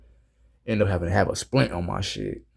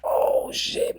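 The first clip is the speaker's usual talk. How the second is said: breathless whispered curse in pain. Retold or imitated. Retelling smashing his finger, strained pained whisper. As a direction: hiss it through the hurt. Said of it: "I'm like".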